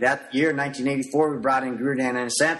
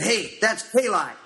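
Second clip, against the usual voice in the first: eager recognition; 7 semitones higher than usual. Retold or imitated. Spotting the knife drills, eager.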